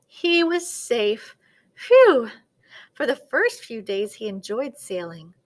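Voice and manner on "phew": high, loud "phew" of relief; big relief. A narrator's reading. Narrator voices the turkey safe at last, big relief.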